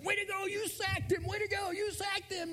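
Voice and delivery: high, shouted cheer, repeated; coaches cheering. Retold or imitated. Coaches on the sideline cheering the boy, high shout. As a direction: yell it like a thrilled youth coach. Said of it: "Coaches on the sideline".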